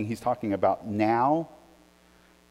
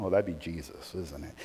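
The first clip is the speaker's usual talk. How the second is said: soft knowing aside; 7 semitones lower, 9 dB quieter than usual. Real, not acted.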